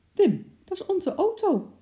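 high, loud excited cry; excited call. Acted. Wife alerts her husband Wim, high excited call. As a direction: burst out, all worked up. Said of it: "riep ze opgewonden".

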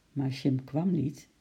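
ordinary delivery

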